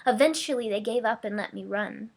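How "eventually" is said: plain reading voice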